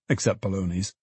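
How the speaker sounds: ordinary delivery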